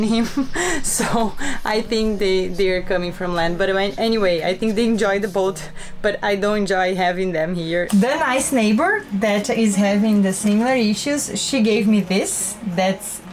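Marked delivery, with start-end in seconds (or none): none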